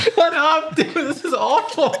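high squeal of laughter; laughing. Real, not acted. Player laughing at the bad accents, high and squealing. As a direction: protest through laughter. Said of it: "laughing at the accents".

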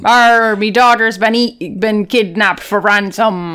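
hammed-up pirate, slowing at the end; pirate trying to pass as Scottish; pirate accent. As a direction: lament in a mock pirate accent. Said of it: "if you do a pirate accent".

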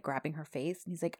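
normal talking voice

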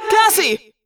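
high, loud call of a name; yelled. A narrator's reading. Jake calling to Cassie in the fight, high yell. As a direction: shout her name across the dark. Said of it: "I yelled".

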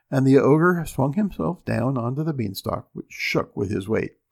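usual speaking voice

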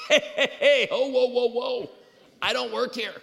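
high, loud comic backpedal; mock alarmed protest. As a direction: cut them off in exaggerated alarm. Retold protest at being mistaken.

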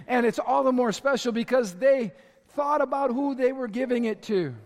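normal talking voice

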